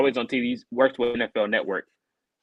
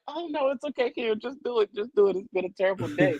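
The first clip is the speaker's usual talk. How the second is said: laughing; 11 semitones higher than usual. Retold or imitated. Speaker retells his own reply.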